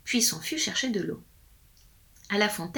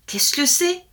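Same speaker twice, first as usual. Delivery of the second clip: higher, alarmed question; frightened wife; 9 semitones higher, 4 dB louder than usual. A narrator's reading. Wife asks her husband about the noise, frightened. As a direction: ask it in a high, worried voice. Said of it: "effrayée".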